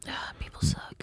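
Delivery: breathy whispered aside. Real, not acted. Reacting to the inbred dog story, a whispered aside. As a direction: mutter it under your breath in a whisper.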